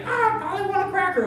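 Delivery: high squawking parrot. Retold or imitated. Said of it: "whatever that bird says in the movies".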